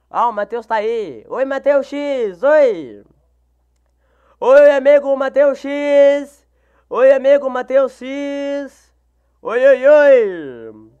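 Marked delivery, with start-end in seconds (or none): 1.3-8.9 loud, drawn-out sing-song hello; stretched, happy shout-out; exaggerated greeting. Real, not acted. Streamer greeting viewer in sing-song. 9.4-10.9 slow, loud sing-song oi; playful stretched hellos; drawn-out greeting. Real, not acted. Streamer greeting viewer.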